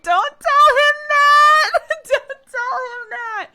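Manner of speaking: high, loud, slow yelp through laughter, reliving the panic; retold laughing shout. Retelling her panicked reply to Sam.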